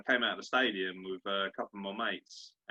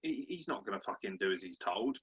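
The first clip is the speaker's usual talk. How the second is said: ordinary delivery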